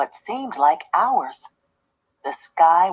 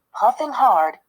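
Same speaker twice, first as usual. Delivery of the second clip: usual speaking voice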